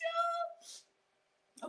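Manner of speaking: high, laughing-crying cheer; joyful cheering. Real, not acted. Cheering her first loaf.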